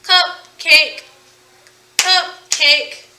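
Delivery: CUP, pause, CAKE, each its own beat; two-beat cupcake, said twice; split syllables. Shown as a demonstration. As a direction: separate cupcake into two parts. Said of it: "two syllables".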